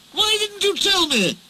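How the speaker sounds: high, indignant near-shriek; almost shrieking. A narrator's reading. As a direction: cry out in disbelief. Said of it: "he almost shrieked".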